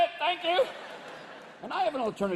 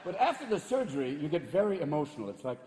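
usual speaking voice

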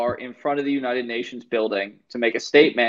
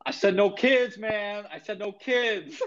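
high, offhand mock Tony; 8 semitones higher, 4 dB quieter than usual. Tony justifies the shooting to passengers, high mock-casual. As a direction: shrug it off, pitched up.

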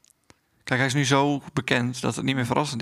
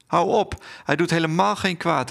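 calling out; 5 semitones higher, 3 dB louder than usual. Acted. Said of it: "riep de moeder".

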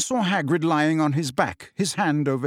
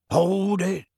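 sudden higher-pitched warning; abrupt raised order; Hagrid abrupt; 5 semitones higher than usual. Acted. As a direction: halt them all at once. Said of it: "said Hagrid abruptly".

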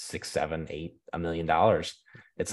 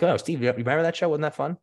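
high, quick imitation of an excited client; chirpy imitated woman; 8 semitones higher, faster than usual. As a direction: mimic her gushing, higher and faster. Group asks him about eighties show.

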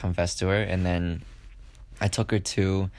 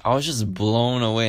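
ordinary delivery

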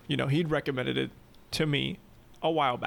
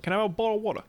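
slightly raised mock British accent; jokey British delivery; 3 semitones higher, much faster than usual. Shown as a demonstration. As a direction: put on a British accent for the request. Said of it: "say it with a British accent".

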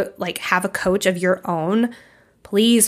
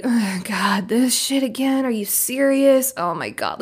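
exasperated brain; 3 semitones higher than usual. Retold or imitated. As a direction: play your bored brain rolling its eyes. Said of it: "Your brain's gonna be like".

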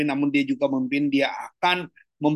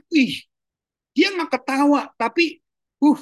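sneering mock; 11 semitones higher, 4 dB louder, slower than usual. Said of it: "Kalau ngeledek orang tuh ngomongnya".

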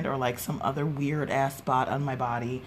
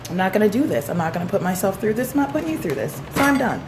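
imitated quitting, climbing higher and louder; mock fed-up refusal; 5 semitones higher, 5 dB louder, faster than usual. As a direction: act out someone throwing in the towel. Acts out student refusing to continue.

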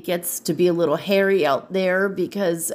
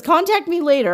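quoting the spirit guide; 12 semitones higher, 4 dB louder than usual. Retold or imitated. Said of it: "He says, he says".

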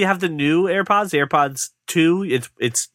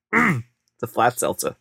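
raspy throat after a coughing fit; clearing a sore throat while talking; hoarse after coughing; 5 semitones lower than usual. Real, not acted. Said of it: "What is wrong with you right now?".

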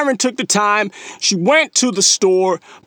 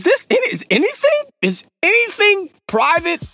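much higher, disbelieving is anything private; incredulous; 10 semitones higher than usual. Retold or imitated. Host retelling his shock at his manager about the audit, incredulous. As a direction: ask it as if you can't believe it. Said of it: "I'm like".

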